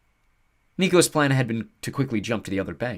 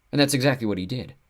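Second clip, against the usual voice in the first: usual speaking voice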